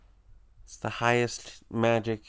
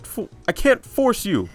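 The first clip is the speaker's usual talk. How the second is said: high, loud, pleading hard sell; manic salesman puppet; 14 semitones higher, 16 dB louder than usual. Acted. Puppet urging Chris toward power, loud manic pitch. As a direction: wheedle loudly and high, swelling on the big pitch. Voices game character's dialogue.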